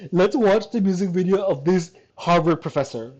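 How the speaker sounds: ordinary talk, nothing marked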